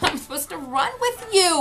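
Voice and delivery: plaintive retold protest, drawn up high; retold near-tears. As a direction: replay being hurt and about to cry. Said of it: "right then I wanted to cry".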